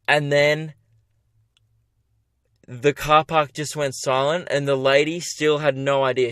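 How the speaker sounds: ordinary delivery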